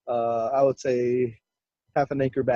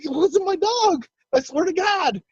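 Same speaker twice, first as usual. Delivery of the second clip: insistent denial. Retold or imitated. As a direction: swear it to your accusing uncle. Swearing dog is innocent.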